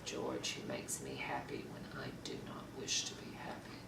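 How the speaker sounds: soft, low and heavy with regret; subdued, sorrowful confession; quiet regret. Acted. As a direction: confess it quietly, dropping almost to a whisper. Acted monologue of marital regret.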